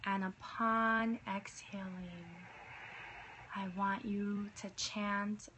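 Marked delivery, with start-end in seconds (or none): 0.1-2.5 guided meditation. Acted. Leads a meditation.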